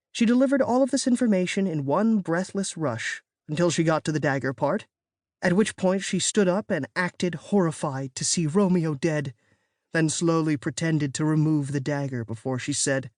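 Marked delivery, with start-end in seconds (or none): none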